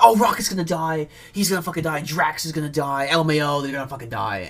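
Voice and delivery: mock panicked fans. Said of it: "everyone thought".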